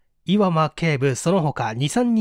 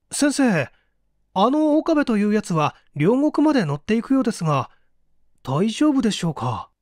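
put-on eager young assistant; 6 semitones higher than usual.

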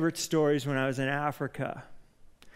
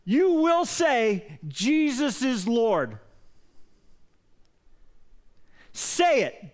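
yelled command; 7 semitones higher, much slower than usual. Retold or imitated. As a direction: bark the order at the girl slowly. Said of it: "They like to yell".

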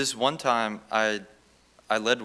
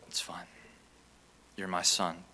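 gentle reassurance; 4 semitones lower, 7 dB quieter than usual. Retold or imitated. God answers gently.

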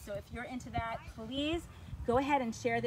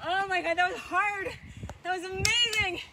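high, loud burst of relief; thrilled, winded coach celebrating the finish; real elation; 8 semitones higher, 7 dB louder than usual. Real, not acted. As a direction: exclaim it proudly right after a hard workout. Celebrates finishing five hundred reps.

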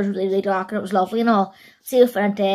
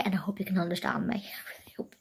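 soft unvoiced whisper; 3 semitones lower, 12 dB quieter than usual. Real, not acted. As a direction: whisper it under your breath. Whispered aside.

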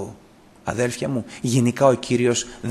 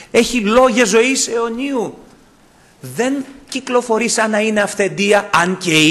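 raised, ringing preacher's voice; fervent preaching; 9 semitones higher, 6 dB louder than usual. Acted. Preacher exhorting the congregation, loud and raised. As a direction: proclaim it to the whole church. Preacher rising to full force.

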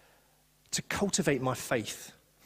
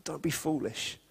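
gentle God; 6 dB quieter, slower than usual. Retold or imitated. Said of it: "And then God's like".

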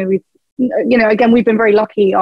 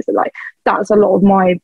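ordinary talk, nothing marked

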